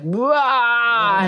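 imitated primal pushing yell; mama bear roar. Telling friends the noise made when pushing, imitated primal roar. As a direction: let out a long, high mock birthing roar. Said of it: "mama bear primal".

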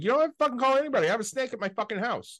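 high, fast, exasperated rant at mom; retold angry outburst. Son snapping at his mother about the toilet, exasperated rant. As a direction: vent like you're yelling at your mother over the phone. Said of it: "I'm like".

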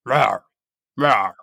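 short warning roar, repeated; lion roar. Shown as a demonstration. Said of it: "Can you demonstrate?".

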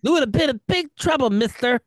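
high mock baby scolding. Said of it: "a better baby voice".

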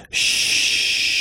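long, steady "shh"; hushing shush. Acted. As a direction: shush the wind, finger to lips. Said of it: "he put his finger to his mouth".

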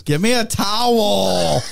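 slow, stretched-out Southern towel; mock Southern drawl. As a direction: drag out towel in two syllables, hammed-up. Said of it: "who says towels?".

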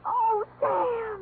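catching a sob on his name; choked-up plea; tearful. Acted. Secretary distraught, cry heard.

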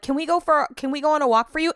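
high little-kid voice, lightly put on; imitated little girl. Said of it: "The little girl was like".

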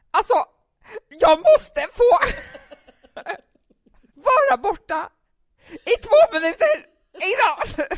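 loud, high, slow tearful wail; crying outburst. Retold or imitated. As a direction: plead through tears to get two minutes alone. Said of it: "För jag var ju gråtig".

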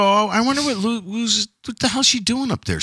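puzzled high inner voice. Retold or imitated. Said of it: "so I go".

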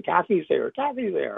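mildly panicked, pitch jumping up; freaked out. Retold or imitated. Teenage brother running out after seeing his sister, freaked out. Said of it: "he's all freaked out".

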